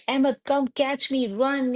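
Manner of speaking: lightly voiced excited kid; playful call. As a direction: call out like a little boy starting a chase. Little son calling to twins.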